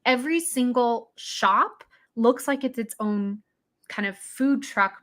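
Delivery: normal talking voice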